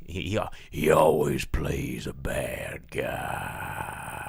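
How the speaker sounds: very slow, low gravelly rasp; gruff bad-guy rasp, stretched right out; Michael Wincott. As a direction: growl it out with barely any voice. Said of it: "that's how he talks".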